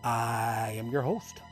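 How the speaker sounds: ordinary delivery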